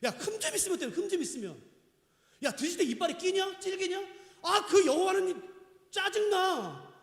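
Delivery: high-pitched griping imitation of an irritated believer; mock annoyed complaint. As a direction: whine it like a fed-up worshipper. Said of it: "근데 이렇게 말해".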